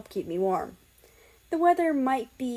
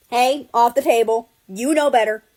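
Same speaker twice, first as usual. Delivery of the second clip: loud sharp scold at a cat on the table; yelling at the cat; 2 semitones higher, 10 dB louder than usual. Acted. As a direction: shout her off the table, loud and firm. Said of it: "he heard me yelling".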